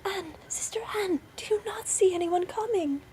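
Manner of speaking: very high, anxious call; wife crying out. A narrator's reading. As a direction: call up to your sister in hope. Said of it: "and then she cried".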